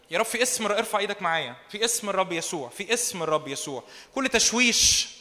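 rhythmic repeated prayer declarations over worship music; fervent prayer. Real, not acted. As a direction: lead the room in prayer, building steadily. Leads congregation in prayer over music.

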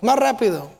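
ordinary delivery

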